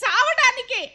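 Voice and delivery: tearful cry of leaving, pitch rising; sobbing threat to go die. Acted. Wife threatens leaving, crying. (భర్తతో ఏడుస్తూ వెళ్ళిపోతానని)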